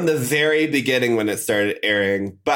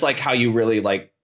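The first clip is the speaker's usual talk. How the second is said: usual speaking voice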